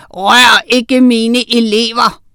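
slow, loud, icy threat; stern, cold command; cold warning. A narrator's reading. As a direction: warn him off word by word. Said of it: "sagde han koldt".

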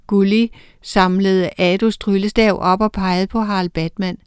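usual speaking voice

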